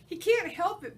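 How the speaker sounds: high, loud gleeful jab; teasing jab. Acted. Bob the dog mocking Ivan's smell, loud high teasing. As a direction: poke fun at the gorilla out loud.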